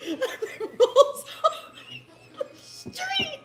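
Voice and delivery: high, breaking up with laughter; helpless laughing. Real, not acted. Telling a friend's drunk windshield fall, helpless laughing. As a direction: squeal it out through real laughter. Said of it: "I can't tell the story without laughing".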